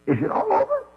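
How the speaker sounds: squeaked out high in disbelief; a shocked little cry; startled question. Retold or imitated. Moses asks God, preacher voicing.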